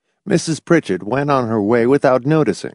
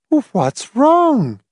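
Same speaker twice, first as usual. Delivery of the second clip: high-pitched anxious matron. A narrator's reading. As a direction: ask in fluttering concern. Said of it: "Missus Pritchett inquired anxiously".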